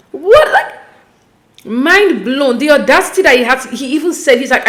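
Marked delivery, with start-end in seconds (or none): 0.1-2.6 flabbergasted. Real, not acted. Host stunned by the man's remark, flabbergasted. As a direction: squeak it out, lost for words. Lost for words in disbelief.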